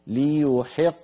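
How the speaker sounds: "ليحق" بقاف ساكنة مقلقلة عند الوقف; اقلب القاف بنبرة ارتداد واضحة; قلقلة القاف. Shown as a demonstration. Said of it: "نعيد". ("ليحق" وقفًا مع قلقلة القاف)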